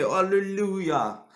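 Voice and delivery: slow sung-out alleluia; drawn-out hallelujah. Pretend. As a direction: stretch it like a choir. Slow drawn-out, far timbre.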